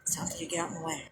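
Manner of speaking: fast whispered warning; whispered threat. Real, not acted. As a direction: hiss it out low, fast and menacing.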